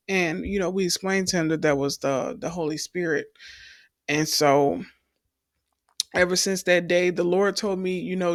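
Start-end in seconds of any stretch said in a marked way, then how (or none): none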